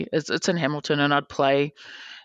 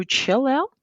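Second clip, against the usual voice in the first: higher, coaxing reassurance; mock soothing advice; 10 semitones higher than usual. Organiser calming the angry player, higher coaxing reassurance. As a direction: coax him to calm down. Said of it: "and I'm like".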